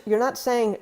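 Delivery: usual speaking voice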